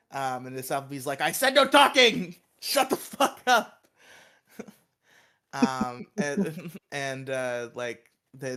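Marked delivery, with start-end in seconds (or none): 1.2-3.9 high, loud teacher yell. Retold or imitated. Miss Appleby yells at the class, high and loud. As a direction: bark it at the class like a furious teacher.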